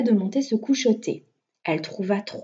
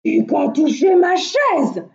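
big bear's raised, loud outcry; loud, higher-pitched big-bear exclamation; Grand Ours; 4 semitones higher, 7 dB louder than usual. A narrator's reading. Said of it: "s'excria Grand Ours".

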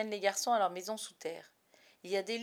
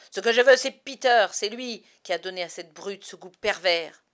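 furious shout; 12 dB louder than usual. A narrator's reading. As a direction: explode with fury. Hook's outburst about Peter. (explosion de colère)

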